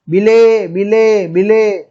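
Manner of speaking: friends calling his name loudly. Retold or imitated. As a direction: call out across the temple, loud and urgent. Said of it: "એમના મિત્રોએ બૂમો પાડી". (બૂમો પાડીને નામ બોલાવવું)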